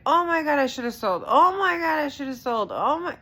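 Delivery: high, loud panicked cry; loud, high-pitched oh my God panic. Retold or imitated. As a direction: shriek it in full panic over the loss. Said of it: "each time I'm like".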